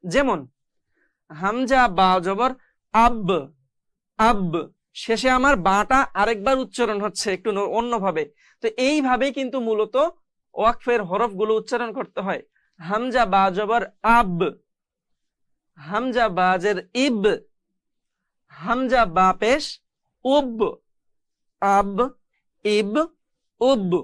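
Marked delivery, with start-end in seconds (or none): 1.3-4.5 slow spelling, then "আব" with its b bounced; qalqalah echo. Shown as a demonstration. Teacher giving students an example, qalqalah echo on the b of "আব". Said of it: "এভাবে আমাদের উচ্চারণ করতে হবে". 12.8-23.9 final b in "আব", "ইব", "উব" bounced; qalqalah echo. Shown as a demonstration. Teacher drilling students, echoed b ending "আব, ইব, উব". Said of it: "এইভাবে করে উচ্চারণ করতে হয়".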